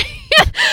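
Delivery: short bright burst of laughter; high laugh. Real, not acted. Host laughing after apologising for ranting, high laugh. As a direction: giggle at yourself.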